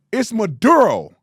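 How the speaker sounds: "Maduro" with hard D and unrolled R, loud; plain English pronunciation. Shown as a demonstration. Host correcting a reporter, emphatic English "Maduro". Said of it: "You're speaking English".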